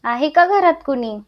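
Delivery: plain reading voice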